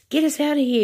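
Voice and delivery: high, loud scream. A narrator's reading. Grandma screaming at Wonka to flee, high scream. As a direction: shriek it at the madman. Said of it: "screamed Grandma Josephine".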